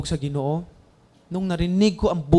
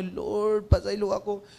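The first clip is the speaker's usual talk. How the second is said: crying plea; 6 dB quieter, slower than usual. Retold or imitated. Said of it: "hilak maayo".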